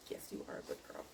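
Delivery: soft whispered praise for the dog; whispering to a dog. Real, not acted. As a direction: hush it lovingly to the pet.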